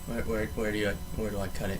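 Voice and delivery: muttering to himself. Real, not acted. Vlogger muttering to himself, hunting for where to cut. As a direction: mumble it as if talking to yourself.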